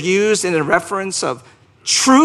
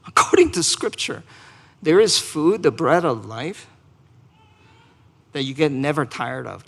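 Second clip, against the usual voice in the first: ordinary talk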